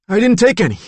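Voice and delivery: high, loud, frightened protest; a scared child denying it; scared denial. Acted. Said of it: "Danny said".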